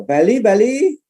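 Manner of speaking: high, loud roll call. Real, not acted. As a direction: call out to find anyone from there. Calling out cities to audience. (memanggil dengan lantang)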